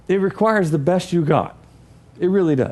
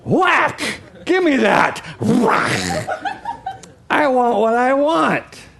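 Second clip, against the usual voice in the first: put-on toddler demanding; 5 semitones higher, slower than usual. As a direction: bark it like a greedy toddler. Toddler grabbing toy voiced.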